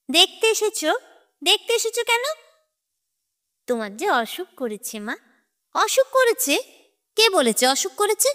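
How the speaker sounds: high, sharp disbelieving questions; agitated demand. A narrator's reading. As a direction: throw each question back, raising pitch. Mad mother flares up. (উত্তেজিত, তীক্ষ্ণ প্রশ্ন)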